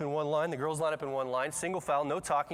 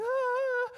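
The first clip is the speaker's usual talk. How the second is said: very high, loud wail; mock child's crying; 5 dB louder than usual. Six-year-old crying before her teachers, exaggerated high wail. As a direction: wail like a frightened little girl. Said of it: "she's, like, crying by this point".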